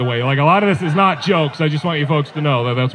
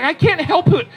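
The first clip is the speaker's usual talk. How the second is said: high-pitched mock-defensive wife; hammed-up defensive protest; 7 semitones higher than usual. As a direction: plead your case in a raised put-on voice. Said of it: "she's all defensive".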